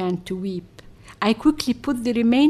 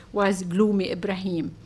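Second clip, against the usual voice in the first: plain reading voice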